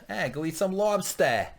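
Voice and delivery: slow, hammed-up Boston accent; mock Boston accent. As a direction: lay the Boston accent on thick, slowly. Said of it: "that's my Boston accent".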